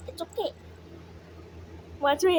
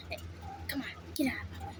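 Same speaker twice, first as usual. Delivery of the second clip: whisper; 2 semitones lower, 13 dB quieter, much faster than usual. Real, not acted. Pushing past pet, hushed.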